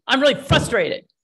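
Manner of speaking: acted exasperation, punched out loudly; frustrated outburst. Acts upset person venting in role-play.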